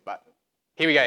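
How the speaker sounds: ordinary talk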